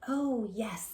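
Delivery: usual speaking voice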